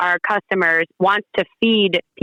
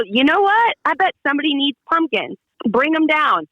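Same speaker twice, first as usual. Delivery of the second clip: much higher, bright burst of resolve; sudden excited yes; 6 semitones higher than usual. Retold or imitated. Retelling her change of heart, excited and higher. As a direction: flip from doubt to eager go-ahead. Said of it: "And I go".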